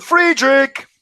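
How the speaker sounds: a loud high call through the door. Acted. Boy at the door calling his friend, loud. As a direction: call his name up the stairs. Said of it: "I called".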